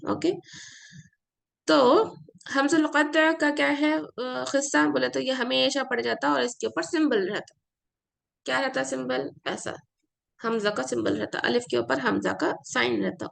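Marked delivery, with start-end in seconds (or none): none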